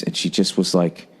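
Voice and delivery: normal talking voice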